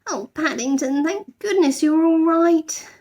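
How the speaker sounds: high slow relief; high tender girl's relief; relieved. A narrator's reading. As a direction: breathe out relief. Judy relieved.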